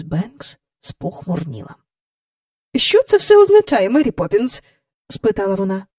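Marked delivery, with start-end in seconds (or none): 2.8-4.6 Mrs Banks demanding. A narrator's reading.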